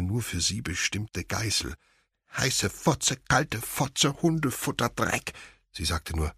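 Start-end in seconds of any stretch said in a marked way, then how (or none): 2.4-5.3 words forced out high and helpless; high tic outburst. Acted. Will blurting tic words at his mother, high and driven. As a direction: let the words spill out in a high rush.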